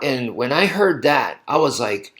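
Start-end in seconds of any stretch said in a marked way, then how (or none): none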